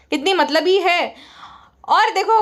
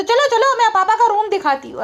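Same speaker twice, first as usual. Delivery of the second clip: high-pitched imitation; mimicked eager woman; 7 semitones higher than usual. Vlogger mimicking a woman offering to show dad's room, mimicked eagerness. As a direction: put on her voice, raised and teasing.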